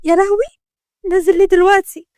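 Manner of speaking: high-pitched panicked wail; alarmed. A narrator's reading. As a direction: panic about being exposed. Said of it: "سمعوا صوت شهقة شمس". (بفزع)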